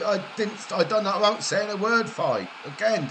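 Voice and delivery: ordinary talk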